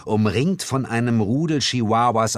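normal talking voice

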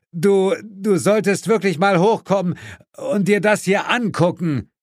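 high, stammering call from above. A narrator's reading. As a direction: call down urgently to your partner. Suko calls down over the noise. (von oben herunterrufend)

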